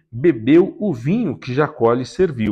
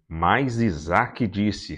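ordinary delivery, nothing marked

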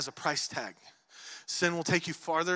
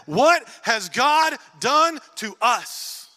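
loud, very slow, stretched out in dread; trembling fear; 6 semitones higher, 8 dB louder, much slower than usual. Acted. Frightened brothers turning to one another in dread, slow and loud. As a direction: ask it as if the floor dropped away.

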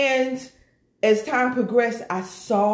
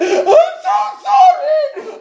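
much higher and louder, half laughing, half wailing; retold wail of relief, pitched way up; loud tearful cry; 9 dB louder, slower than usual. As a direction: burst out with it like the dam broke. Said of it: "She said".

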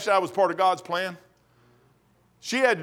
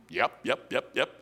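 low muttered agreement; 8 semitones lower, 9 dB quieter than usual. Retold or imitated. King confirms his power.

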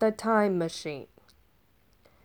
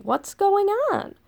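baffled Romans; 10 semitones higher than usual. Retold or imitated. Kids baffled by a phone.

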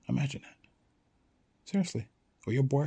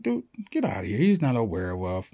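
loud, dismissive friend; mock disbelief, hitting it hard; scoffing disbelief; 5 dB louder than usual. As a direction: scoff and brush the claim off. Acted friend scoffs at the claim.

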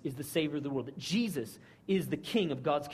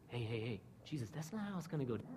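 softer, pulled aside, quick hushed correction; hushed rebuke; 7 semitones lower, 13 dB quieter, faster than usual. Retold or imitated. Peter taking Jesus aside, a soft fast rebuke. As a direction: pull him aside and hush it. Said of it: "he rebukes Jesus".

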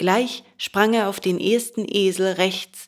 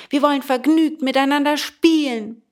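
put-on high, raised boys' call; crowd's shout; 7 semitones higher, 4 dB louder than usual. A narrator's reading. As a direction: tempt him with a high, cheerful call. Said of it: "schrien alle aus dem Wagen". (hoch und munter rufend)

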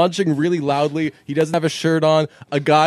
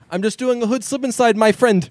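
high, quick mock Indian accent; mock a store owner's Indian accent, pitched up; high-pitched imitation of a prankster's fake Indian accent; 8 semitones higher than usual. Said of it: "He mocks his accent".

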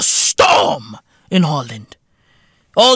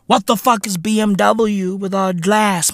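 ordinary talk, nothing marked

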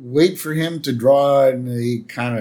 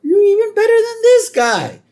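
very high, loud, put-on delight; delighted producer comparing two actors; 7 dB louder than usual. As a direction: squeal the compliment. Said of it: "And Myers is like".

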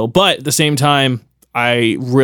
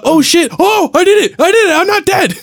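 very high, louder acted whoop of survival; exhilarated relief; 4 dB louder than usual. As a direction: squeal it like you just cheated death, laugh at the end. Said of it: "I miss that feeling of".

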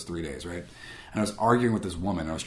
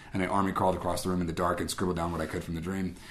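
usual speaking voice

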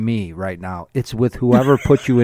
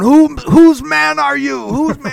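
slow, loud menacing demand; mock Bill the Butcher threat; imitating Daniel Day-Lewis; 11 semitones higher, 5 dB louder than usual. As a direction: growl it like a gang boss. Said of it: "he's going".

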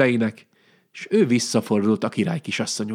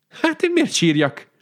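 higher, puzzled surprised question. A narrator's reading. Princess wondering why she should cry, surprised higher question. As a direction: act truly puzzled by the question. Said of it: "csodálkozott".

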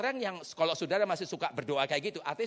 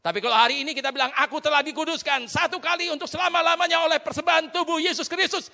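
loud, raised declaration of faith; ringing confession to a congregation; preached declaration; 10 semitones higher, 8 dB louder than usual. Real, not acted. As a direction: proclaim it boldly, building higher and louder.

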